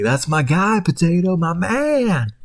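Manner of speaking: high, hyped cheer for his buddy; big-up the potato; proud older hot dog. Retold or imitated. The older hot dog cheers on Potato, high and proud. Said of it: "he's like".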